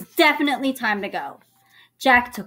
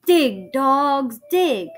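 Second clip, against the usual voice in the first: loud, higher, emphatic command; emphatic title reading; 5 semitones higher, 6 dB louder, much slower than usual. A narrator's reading. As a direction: announce it with punch. Reads out the chapter title.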